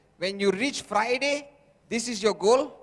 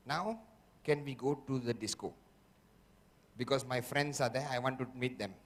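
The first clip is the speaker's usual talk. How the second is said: low, soft, coaxing whisper of temptation; the tempting inner voice; 5 semitones lower, 5 dB quieter than usual. Acted. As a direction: sweet-talk him quietly like a devil on the shoulder. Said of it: "the voice will go".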